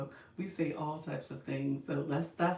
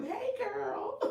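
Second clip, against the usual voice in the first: put-on playful girlfriend greeting. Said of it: "You know how we do".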